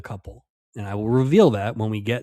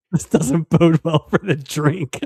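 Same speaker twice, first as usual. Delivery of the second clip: laughing; 4 semitones higher, 5 dB louder than usual. Real, not acted. Laughing repeat of remark.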